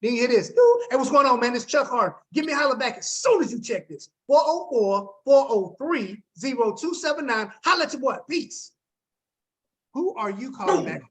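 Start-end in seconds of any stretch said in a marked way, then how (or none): 0.5-0.8 high, loud spoken beep; imitated voicemail beep. Shown as a demonstration. 0.9-8.7 upbeat, lively callback message; energetic voicemail. Shown as a demonstration. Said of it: "the one that's giving you energy".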